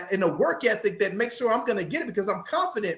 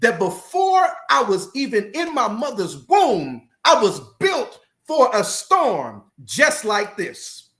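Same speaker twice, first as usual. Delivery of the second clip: preaching; 6 semitones higher, 3 dB louder than usual. Acted. Preaches to listeners.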